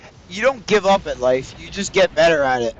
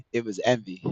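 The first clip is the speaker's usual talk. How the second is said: softer, lower admission; envy said softer and lower; quiet confession; 5 semitones lower, 6 dB quieter than usual. Shown as a demonstration. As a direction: let it drop, quietly admitting. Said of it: "repeated confession".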